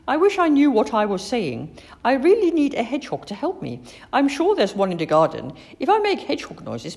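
eager wishing. A narrator's reading. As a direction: think aloud with hopeful energy. Girl eagerly plans to herself.

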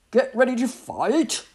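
first mate readying the crew for battle; battle call. A narrator's reading. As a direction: rouse the crew for a fight.

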